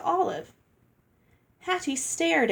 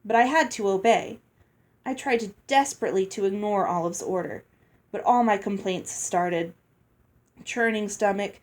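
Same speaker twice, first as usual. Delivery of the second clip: usual speaking voice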